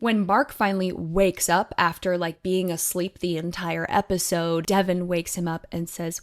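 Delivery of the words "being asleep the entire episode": ordinary talk, nothing marked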